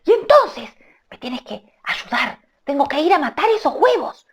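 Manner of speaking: high urgent hushing voice; Rikitiki hushing. Acted. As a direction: mongoose shushing the bird. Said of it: "dialogue reply".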